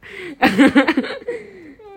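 high loud burst of laughter; real loud laughter. Real, not acted. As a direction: laugh out hard. Laughing at embarrassment.

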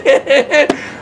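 a short burst of high laughter. Acted. Teacher acting out the woman laughing, high laugh.

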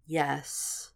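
soft, dragged-out yes; slow, reluctant. A narrator's reading. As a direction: drag the word out quietly, as if it meant no. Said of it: "she said slowly".

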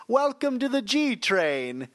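slow, high Caribbean accent, badly faked; hammy Caribbean subway announcer. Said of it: "in my terrible Caribbean accent".

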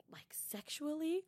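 hushed scandalized whisper; slow whisper of a shocked question; mock whisper.

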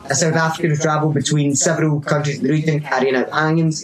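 ordinary talk, nothing marked

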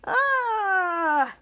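a small pained "ah" while tasting something gross; childlike mock disgusted cry. Girl forcing down gross soup, small cry. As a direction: groan like the soup is awful. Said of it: "Piper was going".

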